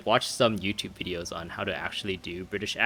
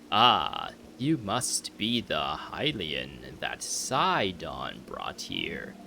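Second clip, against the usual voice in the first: slow, grand royal delivery; a ponderous monarch weighing each word; stately old king; 5 semitones lower, much slower than usual. Acted. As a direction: speak slowly and regally, with swooping emphasis. Voices the king in the game.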